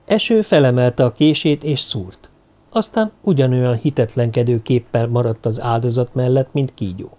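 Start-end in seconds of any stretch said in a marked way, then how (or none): none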